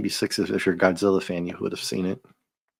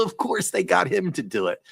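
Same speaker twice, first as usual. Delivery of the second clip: ordinary delivery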